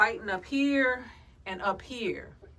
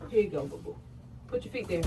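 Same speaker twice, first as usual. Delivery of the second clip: normal talking voice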